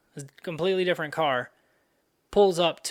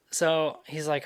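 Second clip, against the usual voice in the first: ordinary talk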